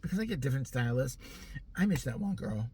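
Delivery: ordinary talk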